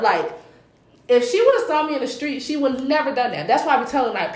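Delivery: ordinary delivery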